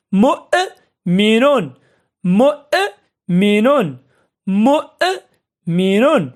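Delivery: مؤمن very slow, a clear break after the hamza; syllable by syllable. Shown as a demonstration. Teacher repeating an example for pupils, مؤمن slowly in parts. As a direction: split مؤمن at the hamza and pause before finishing.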